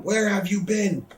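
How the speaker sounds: high, drawn-out mock yell. Vince yelling at a latecomer, mock yell. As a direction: imitate an angry boss calling someone out. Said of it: "Vince starts yelling".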